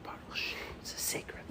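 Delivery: secretive hush; confiding the secret in a hush; whisper. Shown as a demonstration. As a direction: lean in and whisper it. Preacher drops to whisper.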